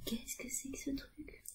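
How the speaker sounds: whispered question. Retold or imitated. Friend's whispered question, retold.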